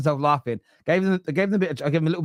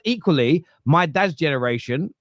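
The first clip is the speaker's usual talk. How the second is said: normal talking voice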